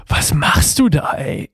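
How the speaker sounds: hushed, breathless whisper of a worried dad; whispered dad thought. Retold or imitated. Dad thinking to himself as kid runs downhill, whispered. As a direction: whisper it like a father watching his kid slip. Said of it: "denkst du dir als Papa".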